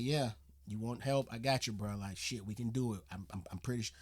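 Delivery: low, soft, casual street talk; early Daymond John. Retold or imitated. Reactor mimics young Daymond John, low casual street talk. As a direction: lightly mimic his old relaxed way of talking. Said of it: "talking like this".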